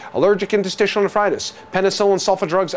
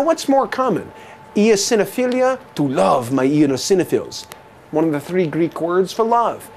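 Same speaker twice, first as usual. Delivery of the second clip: normal talking voice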